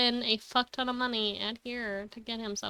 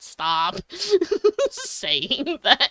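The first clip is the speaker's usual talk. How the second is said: laughing through it; 8 semitones higher, 3 dB louder, much slower than usual. Retold or imitated. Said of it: "Bitch, I'm, like".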